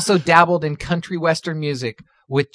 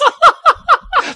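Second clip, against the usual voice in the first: high laugh bursting out; cracking up, laugh breaks loose; burst of laughter; 7 dB louder than usual. Real, not acted. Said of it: "Sorry, I'm losing it".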